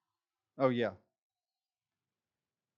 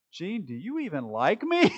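laughing, higher and louder; laughing plea; 6 semitones higher, 5 dB louder than usual. Acted. Pastor playfully pressing Gene, laughing loudly. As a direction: ask it through a laugh. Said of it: "And I say".

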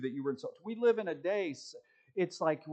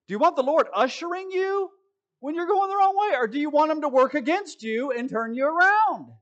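much higher, louder preaching; full preaching; 11 semitones higher, 9 dB louder than usual. Real, not acted. Preacher challenging the congregation, high and loud. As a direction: drive the question home from the pulpit.

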